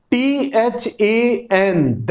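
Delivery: letter by letter, very slow; spelling out slowly. Shown as a demonstration. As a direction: spell each letter out for students. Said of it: "और उसके साथ than".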